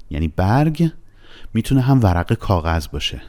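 usual speaking voice

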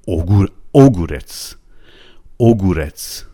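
اوگورتس with a full o, stressed up front; wrong o, first-syllable stress. Shown as a demonstration. Teacher showing what it would be, اوگورتس with stressed o. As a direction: punch the opening او of اوگورتس.

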